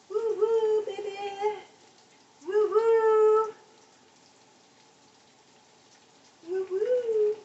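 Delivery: high, loud woo-hoos; celebratory whoop. Real, not acted. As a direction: whoop it out on a high held note.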